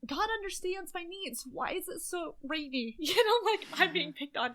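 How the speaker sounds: high, mock-aggrieved whine at the rain; mock complaint. Complaining to Phil about the rain, mock whine. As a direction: play it as a hammed-up grievance to God. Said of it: "I was saying, like".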